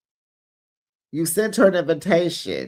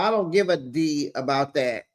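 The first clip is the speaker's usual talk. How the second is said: normal talking voice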